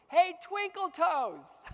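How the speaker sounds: high, loud, slow mocking jeer; sing-song it to mock him; taunting bully. Bullies jeering at the boy skater through the fence.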